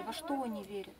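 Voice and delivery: ordinary talk, nothing marked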